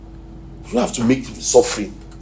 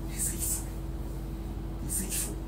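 much softer, whispered with no voice; whispered refrain; 20 dB quieter than usual. Real, not acted. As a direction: hush it to a whisper for the pews. Preacher repeating the refrain in a whisper.

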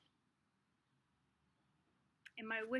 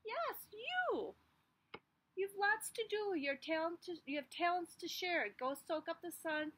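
higher, upbeat encouragement; encouraging friend; 6 semitones higher than usual. Acted. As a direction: cheer the seed on. Encouraging voice to seed.

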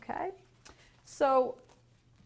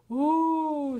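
drawn-out, higher, louder "ooh"; awed chorus of crocodiles; 5 semitones higher, 7 dB louder than usual. A narrator's reading. Little crocodiles awed by the sacred eye, impressed ooh. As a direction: let out a big impressed ooh. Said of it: "said all the little crocodiles".

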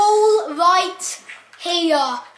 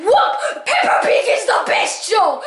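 little sister; 4 semitones higher, slower than usual. Retold or imitated. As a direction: imitate a kid sister's outrage. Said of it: "And she was like".

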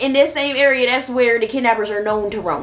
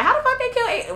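high, astonished question; mock disbelief; 4 dB quieter than usual. Doubters online, mock disbelief. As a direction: voice the doubters, incredulous. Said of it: "people are like".

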